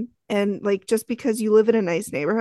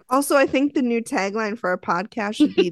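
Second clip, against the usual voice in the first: ordinary delivery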